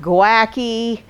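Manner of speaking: "gouache" misread as go-ACK-ee; demonstrating the mistaken go-ACKY for "gouache"; mispronunciation. Shown as a demonstration. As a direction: say "gouache" wrongly with three syllables, go-ACK-ee. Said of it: "not gouache, not any of those things".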